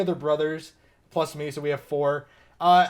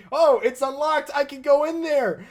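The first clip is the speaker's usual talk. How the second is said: naive inner thought; 8 semitones higher than usual. Retold or imitated. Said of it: "'cause I thought".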